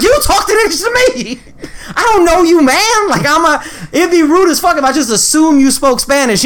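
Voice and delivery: high-pitched retold indignation; exasperated protest. Defending himself to customers who switched languages, high exasperated protest.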